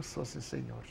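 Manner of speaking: retold quiet, deferential yes; meek reply. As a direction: drop the volume for a submissive little reply. Meek reply to confronting blind man.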